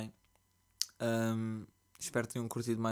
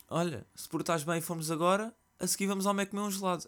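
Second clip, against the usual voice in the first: higher, fast, sugary bribing mother; mocking the mother; 8 semitones higher than usual. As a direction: mimic her sweet bribe, quickly. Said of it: "O que é que ela me diz?".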